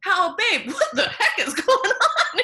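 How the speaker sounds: high, tender, laughing coo to herself; loving self-talk. Pretend. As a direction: coo at your inner rebel like a sweetheart. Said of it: "look at that energy".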